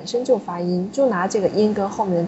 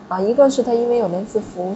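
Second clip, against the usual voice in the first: normal talking voice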